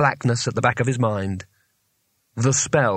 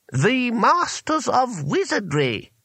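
high, slow, grandly drawn out; haughty; 12 semitones higher, much slower than usual. A narrator's reading. Wizard declaring who is behind the door, haughty. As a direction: announce it with lofty self-importance. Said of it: "said the voice haughtily".

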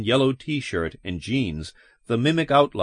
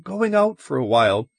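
higher-pitched, wavering mother's voice; wavering call; 7 semitones higher than usual. A narrator's reading. Mother telling children they're going out, wavering. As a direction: call out high, with a slight waver. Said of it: "Janet called in a wavering voice".

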